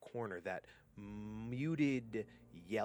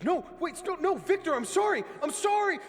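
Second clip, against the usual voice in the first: building from frantic begging to a shrieking shout; louder and louder pleading; 17 dB louder, faster than usual. Acted. Driver begs Victor before being killed, rising shrieks. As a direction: beg harder as death comes. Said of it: "get louder and louder".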